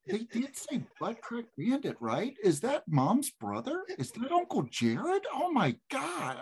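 put-on high-pitched questioning kid voices; imitated excited kids. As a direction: play children making a shocking discovery. Voices his kids' excited questions.

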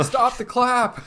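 high, slow, exasperated yell; mock exasperated yell. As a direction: yell like sanity's gone. Quoting a tweet, yelling.

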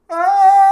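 high loud scream fading away; distant scream. Retold or imitated. Friend screaming down the street, doppler scream. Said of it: "Ten minutes later".

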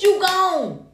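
ordinary talk

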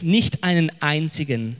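normal talking voice

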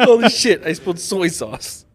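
laughing echo. Retold or imitated. Laughing while echoing the video.

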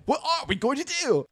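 high, loud overacted worry; hammed-up panic. Teller riffing on the bad script, high hammed-up panic. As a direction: shriek it like a bad soap. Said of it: "It's like a bad script".

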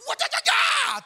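stuttering high-pitched yell; straining high note. Acted. Preacher acting out a wild shot, straining high yell. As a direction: yell it at the very top of your voice. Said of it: "I can't hit that note".

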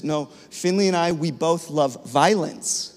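slowing down to spell out "violence" clearly, each syllable distinct; slow and enunciated. Retold or imitated. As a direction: carefully enunciate "we both love violence", slow and deliberate. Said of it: "slows down and enunciates and says".

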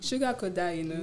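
soft, on the edge of laughter; hushed joke. Pretend. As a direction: say it quietly through a suppressed laugh. Joke said quietly, then repeated.

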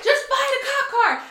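high, slow, exasperated cry; retold yell. Woman to boyfriend during chase of police, exasperated yell. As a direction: yell over someone, stretching the words. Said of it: "He's yelling at me".

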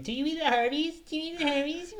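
high mocking voice; squeaky nagging imitation; mocking Rye. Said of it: "every single day he's like".

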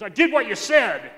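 forceful mimicked announcement; imitated blurting uncle. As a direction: burst out with it like the excited uncle. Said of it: "He's just like that".

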